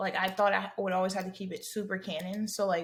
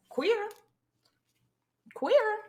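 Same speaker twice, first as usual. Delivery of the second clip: high-pitched, put-on little chirp; playful high voice; 13 semitones higher, 5 dB louder than usual. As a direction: pop each word up high. Playfully labels ex's mom.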